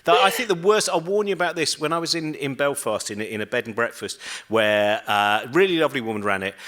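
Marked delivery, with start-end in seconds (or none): none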